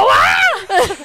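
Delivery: mock terrified yelp; high frightened squeal, half laughing; laughing scared scream. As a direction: shriek it high and loud through a laugh. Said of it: "hon blir så rädd".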